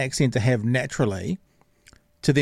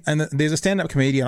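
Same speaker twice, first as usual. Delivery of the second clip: ordinary talk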